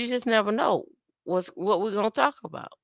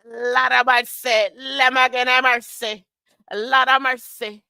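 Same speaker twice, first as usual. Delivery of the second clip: loud, raised Jamaican dancehall shout; 6 semitones higher, 5 dB louder than usual. Acted. Host hyping a viewer's name, loud Jamaican dancehall call. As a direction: belt it like a Jamaican hype man. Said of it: "go into a Jamaican dance".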